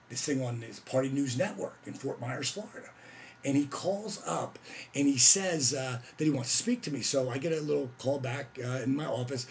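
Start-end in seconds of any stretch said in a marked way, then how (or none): none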